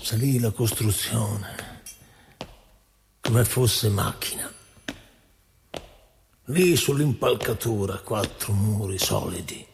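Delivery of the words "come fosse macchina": normal talking voice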